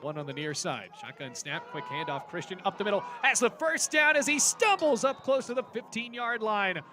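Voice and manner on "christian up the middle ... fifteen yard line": loud high shouted play call; excited shout. Real, not acted. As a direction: shout it as the runner breaks free. Long run called live.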